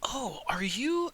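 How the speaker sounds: high, slow, breaking with awe; awed wonder. Acted. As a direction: gasp it out in wonder. Tilda marvels at elf ears.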